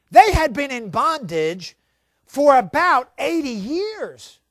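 ordinary talk, nothing marked

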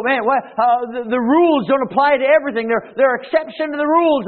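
mock frustrated foreigner baffled by English; exasperated learner. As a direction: throw up your hands at the rules. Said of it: "you go".

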